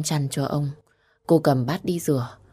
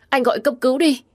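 much higher, desperate urging; frantic plea; 10 semitones higher, 4 dB louder, faster than usual. A narrator's reading. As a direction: plead harder, near a cry. Repeats plea with more desperation. (van nài cuống cuồng)

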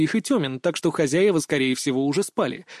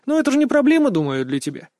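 teasing, starts high and drops back; suppressed smirk; 8 semitones higher, 4 dB louder than usual. A narrator's reading. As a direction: ask as if barely holding back a smirk. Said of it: "еле сдерживая усмешку". (с едва сдерживаемой усмешкой)

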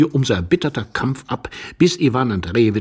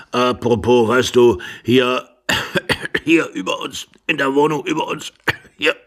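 put-on old man broken up by coughing, higher and louder; coughing old husband; 4 semitones higher, 3 dB louder than usual. Old husband mentions flat upstairs between coughs, halting. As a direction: struggle through the cough.

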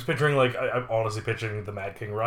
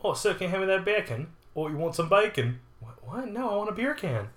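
higher, hammed-up little dialogue; acted skit voices; 7 semitones higher than usual. Customer asks for beer can and gets bacon, mock skit voices. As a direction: act out a confused exchange, pitched up.

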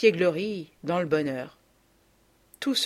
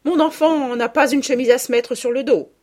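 higher, louder public shout; 6 semitones higher, 7 dB louder than usual. A narrator's reading. Mother shouts at the party about her child, loud. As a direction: make a scene before the crowd. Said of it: "Ma mère crie toujours".

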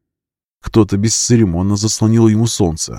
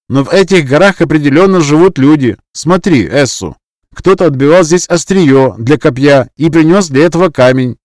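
higher, louder voice of a keen tracker; excited hunter; 6 semitones higher, 7 dB louder than usual. Acted. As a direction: point out the traces eagerly. Enzi reading traces excitedly.

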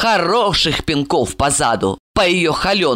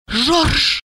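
high, slowed, fearful woman's voice; tipsy woman scared, pitched high; frightened confiding; 12 semitones higher, much slower than usual. A narrator's reading. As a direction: confide your fear like a secret. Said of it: "прошептала".

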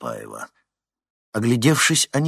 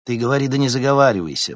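quick sharp reprimand, louder; stern scolding; 5 semitones higher, 5 dB louder, faster than usual. A narrator's reading. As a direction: snap a warning fast. Counselor scolds the DJ. (строгий окрик вожатой)

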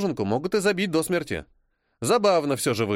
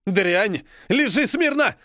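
louder, much higher roar; thundering; 7 semitones higher, 4 dB louder, slower than usual. Acted. The Brit roaring at the pinned Frenchwoman. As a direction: bellow the order at her. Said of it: "гремит британец".

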